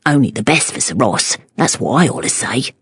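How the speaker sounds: low, soft Cockney muttering. A narrator's reading. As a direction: grumble under your breath, Cockney. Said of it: "muttering to himself".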